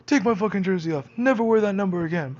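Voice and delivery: high, loud mock rant; ranting Adam. Adam telling him to take off the jersey, high loud mock rant. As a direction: rant like an outraged friend. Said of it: "he's all like".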